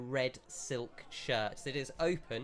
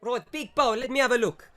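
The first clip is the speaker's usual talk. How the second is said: louder, higher, fast mock Italian shopkeeper; shopkeeper accent; 10 semitones higher, 9 dB louder, faster than usual. Shopkeeper brushes off customer, louder fast mock Italian accent.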